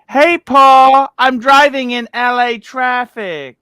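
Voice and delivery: loud, high-pitched shouted catchphrase; catchphrase callout. Acted. Host reading a requested catchphrase aloud, loud callout. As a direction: belt it out like a catchphrase. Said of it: "please say".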